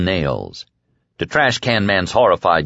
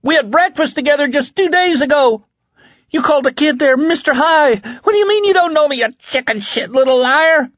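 high, loud, frantic accusation; wild cry; 4 dB louder than usual. A narrator's reading. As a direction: rant wildly in a high, desperate voice. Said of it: "he cried wildly".

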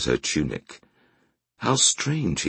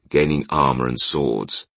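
usual speaking voice

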